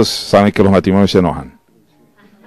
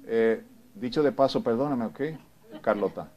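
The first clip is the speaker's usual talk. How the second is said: much softer, absent-minded sorry; softer offhand husband reading the paper; distracted mumbled apology; 17 dB quieter than usual. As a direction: mumble it from behind the newspaper. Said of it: "se baja el periódico y dice".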